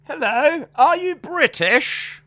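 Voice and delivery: high, slow, hammed-up British accent; exaggerated British accent, words drawn out; put-on British accent. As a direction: greet them in a mock British lilt, pitched high. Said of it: "why am I putting an accent on".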